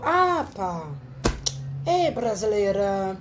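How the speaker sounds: imitating the boss from Portugal; lightly put-on European Portuguese; Portuguese accent. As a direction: say it like a Lisbon boss. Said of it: "Ela falou".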